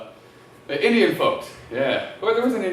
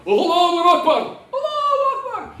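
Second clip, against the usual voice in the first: hammed-up high shouted chant; mock fervent shout; 9 semitones higher, 4 dB louder than usual. Comic mocks a zealot with a high shouted cry.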